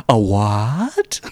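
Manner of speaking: a lightly voiced confused fisherman, "A what?" dragged out; puzzled "A what?" with a slight put-on voice; odd "a what". Fisherman puzzled by the shark name, lightly mimicked. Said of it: "Why does he say it like that?".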